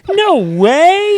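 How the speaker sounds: put-on very high loud kid squeal; toddler no way. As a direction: imitate an amazed toddler. Imitating toddler again.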